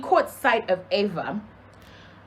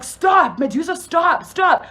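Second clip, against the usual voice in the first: retold pleading shout; 6 semitones higher, 3 dB louder, slower than usual. Retold man fending off attacker.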